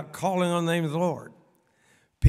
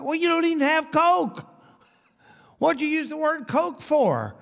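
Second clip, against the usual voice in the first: mock disbelief; 9 semitones higher than usual. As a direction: throw it back in playful disbelief. Preacher retorting to imagined host.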